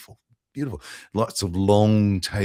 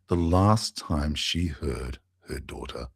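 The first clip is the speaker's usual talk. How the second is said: ordinary talk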